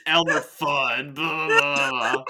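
mocking mimicry. Reader parroting co-host's Elmer Fudd jibe, mockingly.

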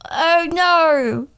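Michael Caine. Acted. Guest as the butler hearing of the film Cats, hammed-up dismay. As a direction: moan it as an impersonated English actor. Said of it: "I think it's Michael Caine".

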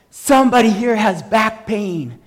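loud, high, slow announcement; calling out. Retold or imitated. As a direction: announce it to the whole street. Retold announcement to the street crowd.

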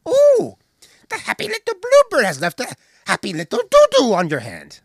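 high squeal, then a mock-sweet remark; a shrieked ooh and a fussy teasing line; Broomhilda's voice.

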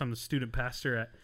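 usual speaking voice

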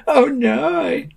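high, drawn-out oh no; dismayed wail. A narrator's reading. Sam hears they are to be breakfast, dismayed.

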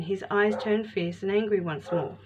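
usual speaking voice

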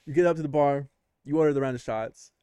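ordinary talk

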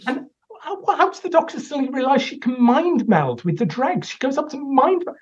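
high incredulous ranting, pitch climbing; exasperated outrage. Real, not acted. Critic ranting to Joe about the mind meld, high and exasperated. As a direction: fire off baffled questions in disbelief.

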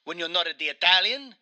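offended Italian, hammed up; Mario with Italian accent. Mario objects to the game's voice, in an indignant Italian accent. Plays Mario being interviewed.

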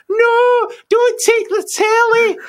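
high, loud, drawn-out mock wail; pleading housemate. Jo begs him to leave the telly, imitated high wail. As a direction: imitate a desperate plea, stretching every word. Housemate's plea acted in a story.

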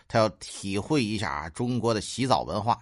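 normal talking voice

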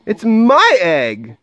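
high, indignant cry; storybook bird's possessive squawk; sputtered. A narrator's reading. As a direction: stretch it out in outraged protest. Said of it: "she sputtered".

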